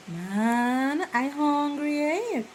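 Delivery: high "Man, I hungry, eh?" with "eh" lifting at the end; dialect phrase. Retold or imitated. Showing how locals say they're hungry, high lilting. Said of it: "you can say".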